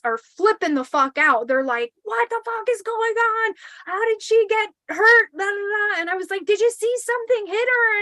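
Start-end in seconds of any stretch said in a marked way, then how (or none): 2.0-8.0 high, panicked voices; frantic questions. Retold or imitated. Teens in the truck panicking over the injury, high frantic voices. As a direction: fire the questions fast in alarm.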